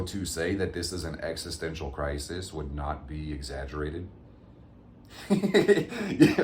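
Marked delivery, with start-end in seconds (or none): 5.3-6.3 high laughing burst; exasperated laugh. Real, not acted. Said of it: "That laugh sounded so exasperated".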